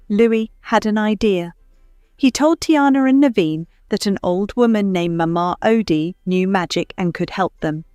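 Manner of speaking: ordinary delivery, nothing marked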